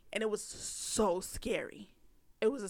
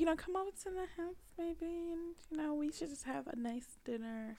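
soft, higher-pitched coaxing; fake sweetness; 6 semitones higher, 9 dB quieter than usual. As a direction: coax gently, pretending all is forgiven. Acts out pretending they are good.

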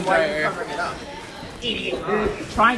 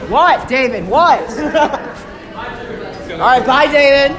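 hyped gamer bro; 5 semitones higher, 6 dB louder, slower than usual. Real, not acted. Hyped in game.

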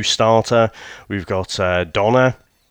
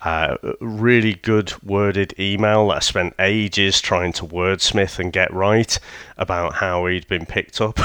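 ordinary talk

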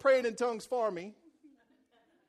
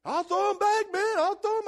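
pleading; 9 semitones higher, 6 dB louder than usual. Retold or imitated. Trespasser pleading.

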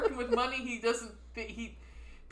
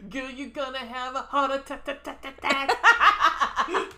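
laughing; 3 semitones higher, 7 dB louder, slower than usual. Pretend. Laughing mock warning.

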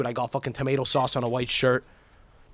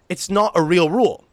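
ordinary talk

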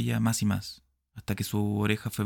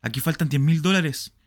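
raised, higher, louder voice; loud alarm; 5 semitones higher, 5 dB louder than usual. Acted. Smith finds ten thousand missing, loud and alarmed. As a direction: push it out in dismay.

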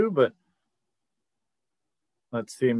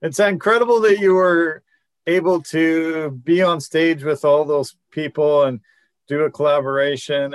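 ordinary talk

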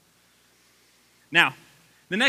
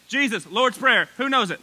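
usual speaking voice

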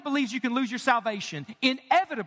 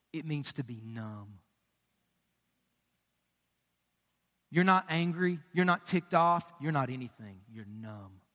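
much softer and lower, dropping to a hush; hushed emphasis; 12 semitones lower, 5 dB quieter than usual. Real, not acted. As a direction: let it sink in quietly. Preacher drops voice for emphasis.

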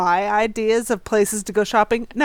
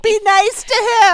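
scolding; 9 semitones higher, 4 dB louder than usual. Retold or imitated. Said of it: "I'm like".

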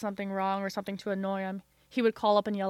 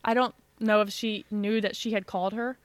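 ordinary talk, nothing marked

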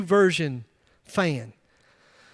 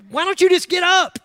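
high, loud, fed-up nagging; exasperated scold; 10 semitones higher, 5 dB louder than usual. Pretend. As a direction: snap at the one hitting snooze. Family scolds late sleeper.

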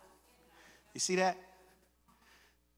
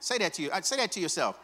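ordinary delivery

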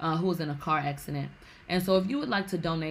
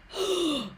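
high acted-out gasp; sharp intake of breath, imitating shock; imitated gasp; 11 semitones higher than usual. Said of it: "They gasped".

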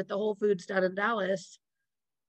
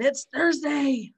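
excited; 6 semitones higher, 4 dB louder, much slower than usual. Retold or imitated. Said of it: "all excited".

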